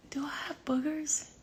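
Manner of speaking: a soft unvoiced whisper aside; self-conscious whispered aside to the camera. Real, not acted. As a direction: whisper it as if checking privately. Checking her face on camera.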